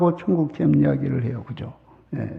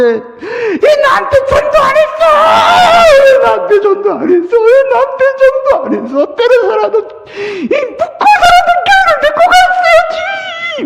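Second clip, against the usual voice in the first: very high shouting, crying reproach; a desperate sobbing scream of blame; wailing accusation; 9 dB louder than usual. Retold or imitated. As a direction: blame them through tears at full volume. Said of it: "이렇게 말하는데".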